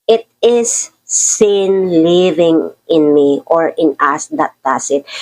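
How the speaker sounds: slow, deliberate Bible reading; scripture reading. Acted. Preacher reads the verse to the congregation, slow scripture reading. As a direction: read each phrase slowly to let it land.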